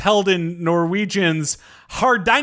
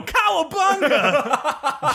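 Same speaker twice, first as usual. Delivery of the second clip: high, slow, laughing surfer cry; cowabunga yell; 9 semitones higher, much slower than usual. Pretend. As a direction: stretch it out like a stunt. Jumping off Everest joke.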